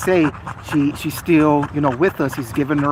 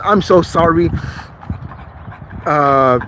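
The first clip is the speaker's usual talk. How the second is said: ordinary delivery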